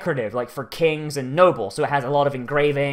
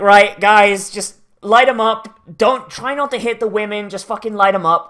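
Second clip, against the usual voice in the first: loud, higher commanding shout; barked orders; 5 semitones higher, 6 dB louder than usual. Retold or imitated. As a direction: yell orders to open fire. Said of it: "that sort of tone".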